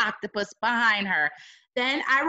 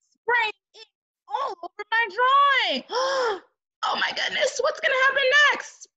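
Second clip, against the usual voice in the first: excited alarm; 13 semitones higher than usual. Acted. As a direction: cry it out in alarm. Reader reacting to attack.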